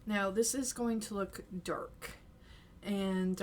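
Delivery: usual speaking voice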